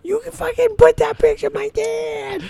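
very high put-on squeal; mock high-pitched voice. Friend quoting the movie to crack up the others, mock high-pitched voice. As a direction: quote the line in a silly falsetto.

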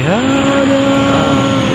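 high, hammed-up cry of dismay; mock dismay. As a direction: wail it like a playful protest. Airplane noise disrupts recording.

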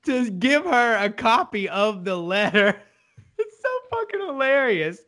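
high-pitched, laughing through the words; amused, laughing delivery. Real, not acted. Recapping a favorite hilarious scene, laughing and high. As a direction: retell it while cracking up.

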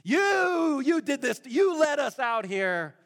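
the crowd shouting at Moses in anger; accusing shout. Retold or imitated. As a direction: jab the finger at Moses. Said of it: "they grumbled against Moses".

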